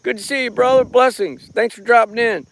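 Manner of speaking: cheery high shout-out to chat names; livestream shout-out. Acted. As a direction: beam a quick hello to viewers. Greets live chat viewers.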